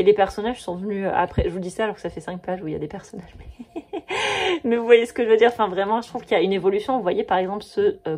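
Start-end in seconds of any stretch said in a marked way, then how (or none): 3.9-4.6 high, bright burst of laughter; a quick self-mocking giggle; amused laugh. Real, not acted. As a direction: laugh at your own slip. Laughs at her own contradiction.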